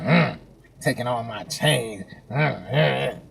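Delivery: low, soft, slow muttering; put-out grumbling under the breath; imitated grudging grumble. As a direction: mimic someone sulking over the change. Mimics cashier grudgingly handing change.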